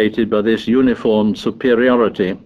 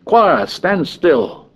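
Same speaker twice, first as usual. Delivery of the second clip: slow, drawn-out commanding shout; shouted order; 6 semitones higher, much slower than usual. A narrator's reading. Head boy shouting orders at his choir. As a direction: bark the choir into line, slowly. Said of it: "The tall boy shouted at them".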